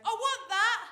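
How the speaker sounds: put-on high whiny toddler want; bratty child grabbing at the till; toddler demand.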